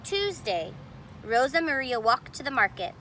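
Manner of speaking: plain reading voice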